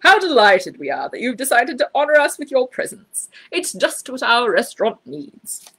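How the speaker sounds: butter up the honoured guest; a mildly voiced oily manager, slowing at the end; fawning manager. A narrator's reading.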